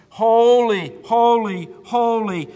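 ringing, forceful repeated cry; calling out. Acted. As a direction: call it out like the seraphs, each word falling. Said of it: "As the seraphists are calling holy".

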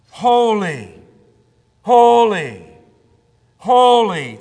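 loud, drawn-out proclamation with falling ends; ringing call, a pause after each. Acted. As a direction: cry each word out like a calling seraph. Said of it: "the seraphs call back and forth".

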